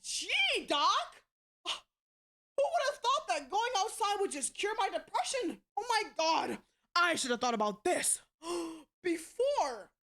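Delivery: high-pitched mock revelation with exaggerated gasps; sarcastic amazement. Daughter mocking mother's cure for depression, sarcastic amazement. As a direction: gush as if the useless advice were genius.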